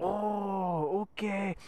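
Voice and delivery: drawn-out "oh" in a mock Korean accent; imitated Korean accent. Korean man hears about the bacon, imitated Korean accent. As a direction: play the Korean man catching on. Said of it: "an older Korean man comes up".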